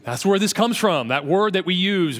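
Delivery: usual speaking voice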